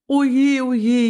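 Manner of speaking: high, loud anxious wail of oh dear; a frightened little dormouse whimpering in alarm. Acted. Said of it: "jammerte Agathe ganz, ganz leise". (hoch und laut jammernd, ängstlich)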